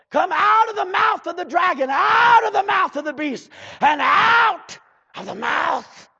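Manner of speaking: loud, high, driving preacher's cry; fiery preaching. Acted. Preacher rousing his congregation, loud fiery preaching. Preacher shouting the scripture text.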